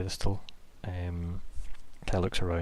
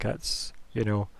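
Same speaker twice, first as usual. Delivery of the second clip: usual speaking voice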